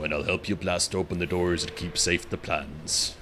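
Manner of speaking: lightly voiced low quest-giver voice; deep put-on voice. Reading the quest giver's line aloud in a low put-on voice. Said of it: "I'll just go really low".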